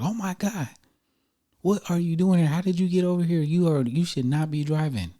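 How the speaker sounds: high, loud shocked exclamation; alarmed scolding nurse; shocked staff voice. Retold or imitated.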